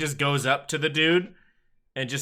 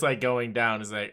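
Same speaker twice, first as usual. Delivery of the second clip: ordinary talk, nothing marked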